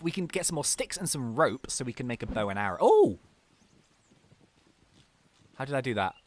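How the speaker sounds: ordinary talk, nothing marked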